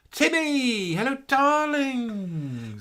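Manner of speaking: high, slow drawn-out hello darlings; camp sing-song greeting. Acted. Greeting a viewer by name in a high, slow croon.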